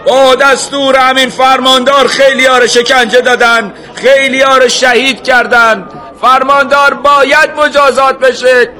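high, loud accusation; outraged shout. A narrator's reading. Someone in the crowd demanding the governor's punishment, shouting. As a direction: yell the charges out over the crowd. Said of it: "یکی فریاد زد".

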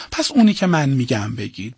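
usual speaking voice

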